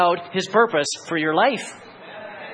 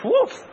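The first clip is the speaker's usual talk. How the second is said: high sharp yelp of surprise; sudden burst; 13 semitones higher than usual. Retold or imitated. Preacher voicing God's sudden comeback, high sharp yelp. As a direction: pop it out like a sudden blast.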